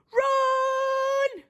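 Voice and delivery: high shouted run; witch shouts run. Acted. Witch warning her guests, high shout. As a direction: yell it to flee. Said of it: "the witch shouted".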